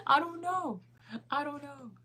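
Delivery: high, giggly and at a loss; high-pitched amused bewilderment; laughing helplessness. Real, not acted. Laughs at own muddled accent.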